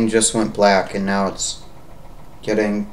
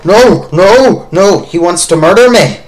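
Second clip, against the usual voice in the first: loud frightened pleading; panicked; 7 semitones higher, 5 dB louder than usual. Real, not acted. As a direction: panic as it chases you. Fleeing monster.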